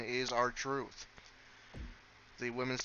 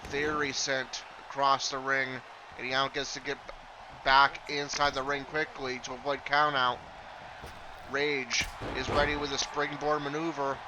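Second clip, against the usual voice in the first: energetic live wrestling call, a bit louder; play-by-play commentary; 2 semitones higher, 3 dB louder than usual. Acted. Commentator calling the match for viewers, energetic play-by-play. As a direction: call the match as it happens, lifting on big moves.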